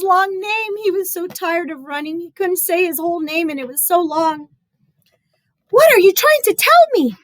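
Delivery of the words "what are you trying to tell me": high loud puzzled man; ask, baffled and loud; puzzled painter. Acted. Painter trying to understand the boy, baffled loud voice. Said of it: "asked the house painter".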